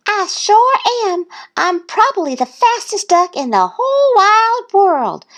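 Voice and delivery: very high little-duck brag; boasting. A narrator's reading. Thistle boasting to Duck, high bragging voice. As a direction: brag in a high, put-on duckling voice. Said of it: "the little duck boasted".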